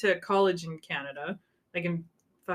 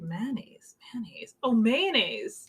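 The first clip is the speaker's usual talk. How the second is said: puzzled whisper; 3 semitones higher, slower than usual. Retold or imitated. A Canadian puzzling over how Americans say it, whispered.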